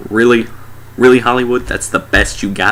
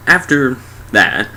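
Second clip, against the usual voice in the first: ordinary talk, nothing marked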